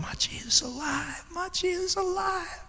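high scream done softly; small, very high mock yell; imitated child screaming. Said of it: "as he's screaming over and".

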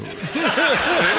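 big burst of high laughter; loud laugh. Real, not acted. Host laughing at his own story.

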